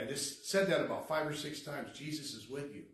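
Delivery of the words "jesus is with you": softly repeated comfort; gentle reassurance. Retold or imitated. Speaker repeating comfort to a tormented man, gentle reassurance. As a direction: say it quietly to settle someone down.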